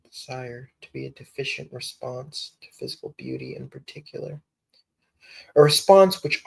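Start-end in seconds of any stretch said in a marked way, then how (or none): 0.0-4.4 reading under the breath, barely voiced; very soft, private read-back; whispered rereading. Real, not acted. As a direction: whisper it to yourself as you reread.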